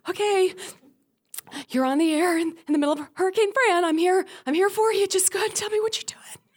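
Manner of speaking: high, tearful mock radio voice; frightened novice host. Young host to callers mid-hurricane, high and terrified. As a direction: squeak through it, trying to sound in charge. Novice's turn during storm, acted.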